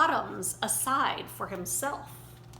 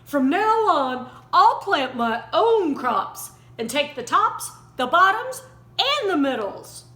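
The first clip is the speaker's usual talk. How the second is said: angry shout; 3 semitones higher, 7 dB louder than usual. Acted. Angry bear declaring.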